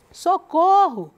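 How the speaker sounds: Socorro called out loud and slow; shouted cry for help. A narrator's reading. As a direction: yell Socorro like you fell in a well. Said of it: "gritou ele". (grito de socorro)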